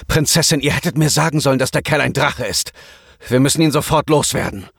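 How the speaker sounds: guard urging the princess to act; lightly voiced alarmed bodyguard. A narrator's reading. Bodyguard Keno warns princess.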